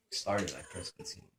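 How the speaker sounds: usual speaking voice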